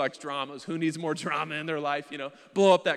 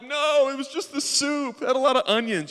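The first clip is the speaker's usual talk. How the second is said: high, loud whiny wail of denial; hammed-up tearful protest; 9 semitones higher, 9 dB louder than usual. Husband caught crying makes excuses to wife, wailing. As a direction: wail a flimsy excuse, stretched out.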